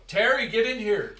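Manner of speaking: loud, high call to someone outside; yell. Acted. Player calls Terry indoors from outside, yelling. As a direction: holler it across a distance. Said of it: "I just yell".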